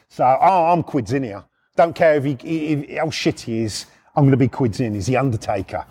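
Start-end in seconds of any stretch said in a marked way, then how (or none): none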